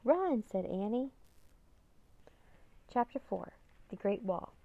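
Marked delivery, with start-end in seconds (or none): 0.0-0.4 high, loud cry to flee; raised, urgent storybook cry; urgent call. A narrator's reading. Annie urges escape from pursuers.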